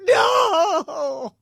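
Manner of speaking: high, loud, drawn-out mock wail; hammed-up shout of despair; mock anguished cry. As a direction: imitate a desperate victim crying out. Voices the shot dog walker.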